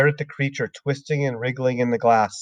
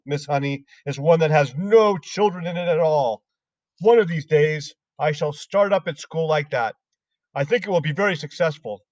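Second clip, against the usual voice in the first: a nasty headmistress mocking small children; higher sneering tyrant of a headmistress; Trunchbull voice; 5 semitones higher than usual. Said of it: "the Trunchbull said".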